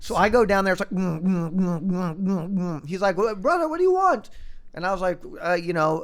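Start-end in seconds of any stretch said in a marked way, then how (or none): 0.9-2.9 hummed thumping dance beat; imitated club bassline. Imitates the club's music beat. 3.2-4.3 high-pitched, rising mock holler; hollered club greeting. Acts out friend shouting over club music.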